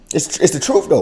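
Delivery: ordinary delivery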